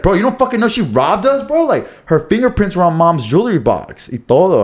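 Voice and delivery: urgent mock indignation, pitch jumping up; retold angry confrontation. Brother breaking the robbery news, mock-indignant.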